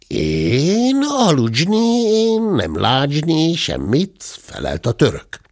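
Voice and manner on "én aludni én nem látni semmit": put-on slow, high broken Hungarian with a Turkish accent. Sleepy Turkish-accented servant answers the bear, slow and high. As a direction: drawl it like a sleepy Turk mangling Hungarian.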